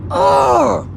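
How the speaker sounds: imitated road-rage roar, pitched low; mock roar of anger; angry growl. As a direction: mimic a furious driver's low yell. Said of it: "the anger".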